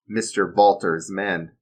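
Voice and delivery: ordinary delivery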